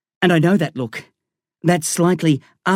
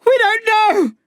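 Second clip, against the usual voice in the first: very high, pleading scream. A narrator's reading. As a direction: scream in desperate appeal, far above your usual pitch. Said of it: "I scream".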